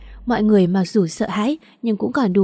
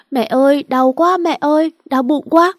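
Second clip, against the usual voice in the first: high, louder wailing moan of pain; crying out; 6 semitones higher, 4 dB louder than usual.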